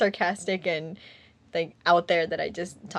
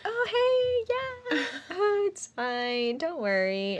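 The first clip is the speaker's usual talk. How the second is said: much higher, sing-song forced brightness; fake work cheer; 11 semitones higher, 3 dB quieter, slower than usual. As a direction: put on a chirpy fake smile for coworkers. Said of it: "I'm like".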